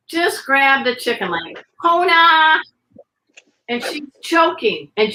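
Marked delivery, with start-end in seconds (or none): 1.8-2.6 calling out. Acted. Calling the dog.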